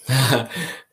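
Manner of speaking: a quick burst of laughter; short laugh. Real, not acted. Host amused by Indigo's message, short laugh.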